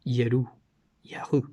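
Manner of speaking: Yeru then Yahu, soft and separate; isolating the syllables. Shown as a demonstration. Pointing out the divine name inside the word, softly. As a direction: split Yahu off and say it softly on its own. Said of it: "Essa primeira parte é o nome".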